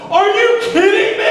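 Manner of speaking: high, loud incredulous yell; outraged outburst. Retold or imitated. Student exploding at the narc writing him up, outraged yell. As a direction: explode in shrieking disbelief. Retold outburst at being written up.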